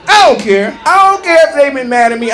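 normal talking voice

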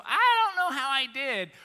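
high, loud flustered wail. Acted. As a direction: blurt it out high and loud in mock despair. Acting out flustered self-doubt.

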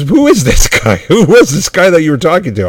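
doctor who can't believe what he's hearing; mock-baffled doctor with a laugh; incredulous doctor. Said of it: "I'm just gonna talk like I was the doctor".

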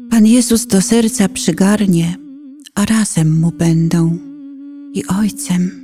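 normal talking voice

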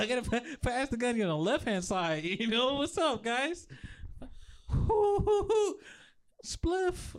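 high, giggly stoned voice; stoner voice. Pretend. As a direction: act baked and giddy.

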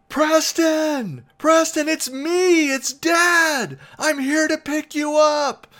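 high, loud drawn-out holler; yelling. Retold or imitated. Dad yelling to his son from the car, loud high holler. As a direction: holler it out to be heard across a school drive. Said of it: "start yelling".

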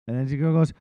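normal talking voice

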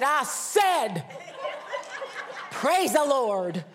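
imitating a loud, high, demanding Southern aunt; insistent mock shout. Aunt insisting until they shout it, loud drawn-out command. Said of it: "until we were shouting it".

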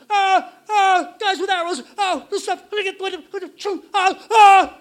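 high, screaming garbled babble; terrified kid raising the alarm; retold hysterical panic. Acts out panicked child to parents.

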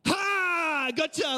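put-on sudden high, loud "Ha!" to catch a kid out; mock ambush yell at a misbehaving kid; playful gotcha shout. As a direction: pounce on them with a gleeful shout. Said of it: "like, going".

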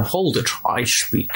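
mimicking the actor's accent, drawn out; slow mock Connery delivery; film impression. Joking with co-hosts about Connery's accents, slow impression.

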